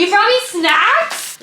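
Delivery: husband hands her snacks, she answers in high delighted surprise. Real, not acted. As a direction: gasp it out, you can't believe he brought snacks.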